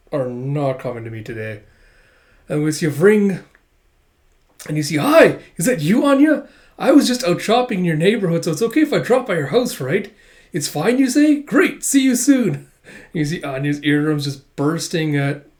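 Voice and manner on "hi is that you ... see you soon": high, loud, gushing chatter; bowl the listener over on the phone; overeager, booming caller. Acted. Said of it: "the volume of Becky's phone call".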